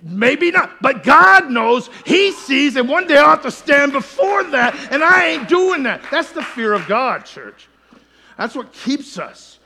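loud, high preaching voice, building to shouts; fiery sermon delivery; full-force preaching. Acted. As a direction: preach it out to the congregation.

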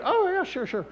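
high-pitched easy agreement; casual agreement. Acted. Seatmate agreeing to listen, airy.

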